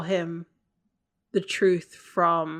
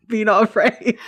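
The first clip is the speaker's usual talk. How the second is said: laughing mock angel; 3 semitones higher, 7 dB louder than usual. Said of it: "we always rephrase it to".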